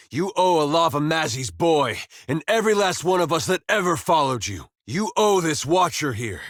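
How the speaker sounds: high-pitched, impassioned accusation without shouting; furious reckoning. Acted. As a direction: call in a debt with rising indignation. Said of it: "like a man overtaken by a furious spirit".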